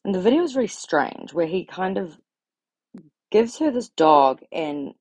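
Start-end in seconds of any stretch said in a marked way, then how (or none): none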